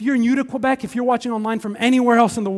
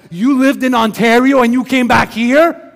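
louder and slow, disbelieving; incredulous; 3 semitones higher, 8 dB louder than usual. Retold or imitated. Friends hearing of the move back to Quebec, loud disbelief. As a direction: stretch out the disbelief.

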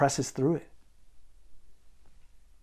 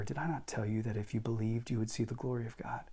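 quiet kind rebuke from Jesus; gentle reassurance; 9 dB quieter than usual. Acted. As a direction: gently ease her doubt, much softer. Jesus gently answers doubting Martha.

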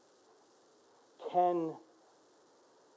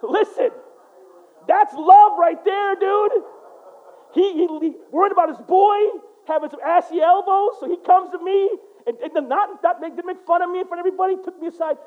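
excited laughter; 10 semitones higher, 8 dB louder than usual. Real, not acted. Laughing delight at the story.

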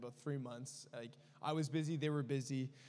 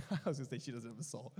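laughing joke; faster than usual. Real, not acted. Joking about sister, laughing.

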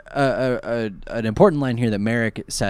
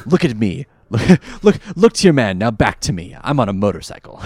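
put-on Old Spice ad voice; 3 semitones lower, 4 dB louder than usual. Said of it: "in an Old Spice commercial".

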